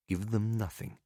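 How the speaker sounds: plain reading voice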